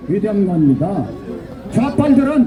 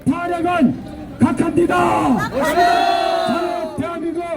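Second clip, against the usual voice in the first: shrill echoing propaganda broadcast; 5 semitones higher than usual. Real, not acted. Rally chant leader.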